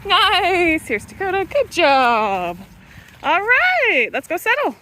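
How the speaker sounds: high loud dog praise; talking to a dog. Real, not acted. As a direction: cheer the dog along.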